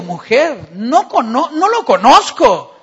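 loud, desperate denial. Acted. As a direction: deny it loudly, cornered. Peter denies knowing Jesus.

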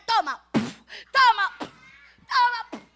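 slow, heavy blows in the voice; hitting taunt. Acted. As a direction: punch each word out. Thugs beating Lucas.

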